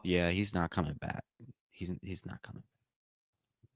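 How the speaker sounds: low, quiet resignation; dejected. Real, not acted. Fan realizing the injured player is finished, quietly dejected. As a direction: voice sinking away, almost gone.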